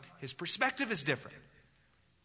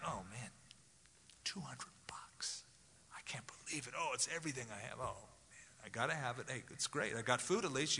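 near-whisper, much softer, stunned at the bill; hushed dismay; 3 semitones lower, 12 dB quieter than usual. Acted. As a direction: mutter it under your breath in disbelief. Acted shopper gasping at the bill.

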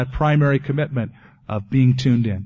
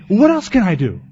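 eager; 7 semitones higher, 7 dB louder than usual. Retold or imitated. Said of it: "And then he'd say".

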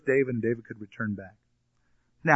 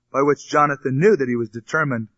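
normal talking voice

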